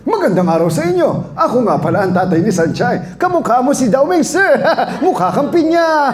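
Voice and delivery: high comic fatherly cartoon voice; Sanchay's father voice. Shown as a demonstration. Voice actor demoing the cartoon dad for students, high and silly. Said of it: "yung boses na tatay ni Sanchay, ganito".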